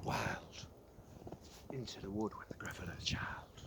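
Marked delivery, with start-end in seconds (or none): 1.7-3.6 soft secretive whisper; hushed whisper. A narrator's reading. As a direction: keep the spell going quietly. Hushed storytelling.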